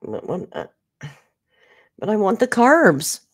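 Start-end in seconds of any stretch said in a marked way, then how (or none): 2.0-3.2 1 whiny plea. Real, not acted. Whining about sides.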